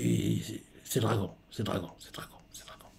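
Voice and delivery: hushed whisper repeating the name; excited whisper. Real, not acted. Viewer recognizing Dragon on screen, whispering to himself. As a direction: whisper it to yourself as it dawns on you.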